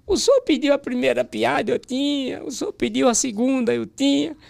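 high-pitched, hammed-up whimpering excuse; mock tearful pleading. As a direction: plead with the boss in a comic crying voice. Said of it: "já fui chorando". (choramingo fingido, voz bem aguda)

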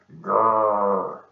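loud beastly jaguar growl. Acted. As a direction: growl like a big cat. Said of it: "a terrible growl".

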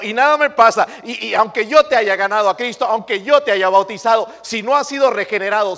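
a pastor thundering at the church; full-force preaching. Acted. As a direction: preach it hard at the congregation.

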